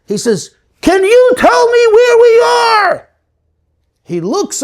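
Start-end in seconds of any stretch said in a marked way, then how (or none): 0.8-3.1 slow, high shout down to the ground; drawn-out high call from a balloon; shouted question. Acted. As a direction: yell it so he hears you far below.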